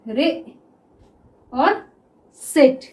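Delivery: रे and सिट said apart with a pause; syllable-by-syllable teaching demo; split into syllables. Shown as a demonstration. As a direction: break receipt into रे and सिट. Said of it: "तो हम कैसे लिखेंगे".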